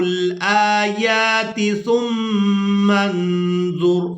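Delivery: slow melodic tajweed recitation; Quran recitation. Shown as a demonstration. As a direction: chant the verse slowly, long vowels held. Full verse recitation.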